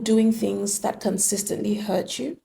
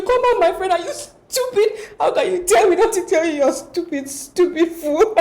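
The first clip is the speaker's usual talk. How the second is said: high, loud taunting parent voice, laughing through it; a sneering African parent mocking the child's protest; mocking insulting parent; 8 semitones higher, 4 dB louder than usual. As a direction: scoff at the child like a scornful mother. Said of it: "they will tell you".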